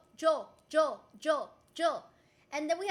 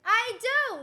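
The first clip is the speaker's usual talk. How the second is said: louder, higher, slow protest; mock indignant; 5 semitones higher, 8 dB louder, slower than usual. Real, not acted. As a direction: insist you do sleep. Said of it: "Stop capping".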